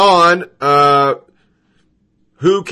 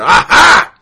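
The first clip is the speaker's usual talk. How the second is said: mock gotcha; 3 semitones higher, 7 dB louder than usual. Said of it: "and go".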